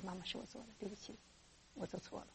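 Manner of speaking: very soft, small admission; sheepish confession. Acted. Child admits wrongdoing to mother, very soft sheepish. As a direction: own up meekly, barely above a murmur.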